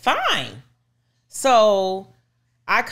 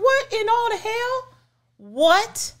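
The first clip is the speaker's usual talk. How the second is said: much higher, shrill stunned outburst; shocked disbelief; slower than usual. Retold or imitated. Wife told her husband needs emergency surgery, shocked high outcry. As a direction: blurt it out in total disbelief.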